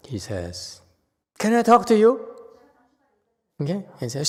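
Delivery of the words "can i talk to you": high, loud call to the master. Retold or imitated. The lady calling the master.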